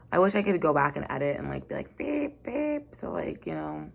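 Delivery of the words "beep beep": high mock bleep over the swear words; censor beep. Censoring her own swearing.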